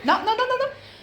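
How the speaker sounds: squeaky rising nos, pitch climbing; high-pitched no to cat. Real, not acted. Woman scolding her cat on camera, high squeaky nos. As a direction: shoo the cat off, voice shooting up.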